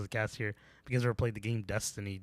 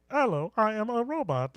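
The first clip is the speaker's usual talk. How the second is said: high, flat robot voice; 10 semitones higher, 3 dB louder than usual. Retold or imitated. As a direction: say it like a nineties robot. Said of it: "Like, like".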